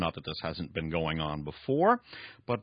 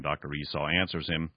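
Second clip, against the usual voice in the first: usual speaking voice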